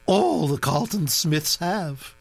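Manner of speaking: put-on high, brisk aristocratic old lady; old duchess voice. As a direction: gossip like an imperious old duchess. Duchess comments.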